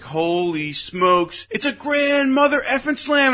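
slow stunned holy smokes, then a high burst; awed then excited. Retold or imitated. Fan watching a grand slam, slow awe rising to high excitement. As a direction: relive the moment of awe.

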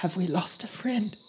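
hushed worried whisper. Acted. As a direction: breathe the question softly. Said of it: "he says".

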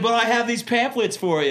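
high, laughing imitation of the eager guy; laughing through quote. Missionary laughing while quoting the pamphlet man. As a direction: giggle out his offer of pamphlets.

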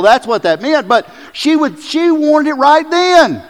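high, loud, driving preacher voice; fired-up preaching. Real, not acted. Pastor preaching to the congregation at full force, excited. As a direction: pour it on, building the point hard. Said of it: "you're awfully excited this morning".

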